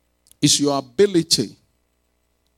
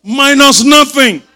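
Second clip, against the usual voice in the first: loud, raised declaration; emphatic call; 8 semitones higher, 8 dB louder, slower than usual. Shown as a demonstration. Preacher correcting the congregation's weak response, emphatic call. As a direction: punch it out for them to repeat. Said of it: "You are not saying it well".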